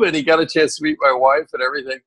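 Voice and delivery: ordinary talk, nothing marked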